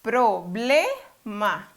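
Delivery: pro-BLE-ma, very slow, each syllable separate. Shown as a demonstration. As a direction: draw out Problema syllable by syllable. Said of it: "¿dónde va la fuerza?".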